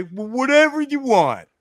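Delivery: high, loud mock eagerness; accommodating worker. Sandwich worker giving in to a customer, exaggeratedly obliging.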